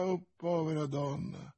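hushed, pitying murmur; broken voice. A narrator's reading. Athos pitying the poisoned woman, broken murmur. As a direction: murmur it softly, grieving. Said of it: "mormorava Athos con voce spezzata".

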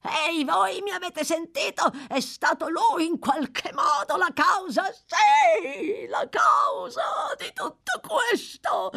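put-on an old man screaming in terror and rage. (urlo disperato, acutissimo)

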